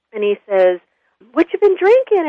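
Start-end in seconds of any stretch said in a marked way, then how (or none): 1.3-2.3 high-pitched friendly question; friendly old man. Retold or imitated. Old man walks over to the drunk, friendly high-voiced question. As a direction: lightly sing it out as the cheery old man. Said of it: "the old man, he gets up".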